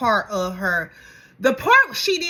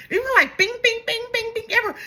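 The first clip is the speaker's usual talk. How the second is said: mock bing bings; 11 semitones higher, 3 dB louder, faster than usual. Mimicking card swipes gleefully.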